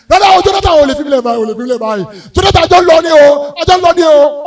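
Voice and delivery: loud, high mock wailing; mom's wailing. Mom wailing at dad's graveside in Edo State. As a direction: cry out his name, begging. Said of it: "mọ́mì mi ń pariwo".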